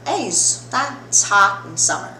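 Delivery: usual speaking voice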